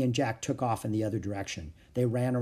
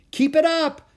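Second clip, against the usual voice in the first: very high, loud cheer; encouraging shout; 10 dB louder than usual. A narrator's reading. Annie to the wildebeest as she runs, encouraging shout. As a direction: shout them onward. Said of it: "she shouted to the wildebeest".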